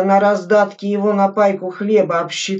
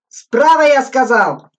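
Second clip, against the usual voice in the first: much higher and louder, the word Справа flung up; exasperated shout; 7 semitones higher, 7 dB louder than usual. A narrator's reading. Lieutenant repeating the order to confused soldiers, shouting higher. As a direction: repeat the order harder because nobody listens.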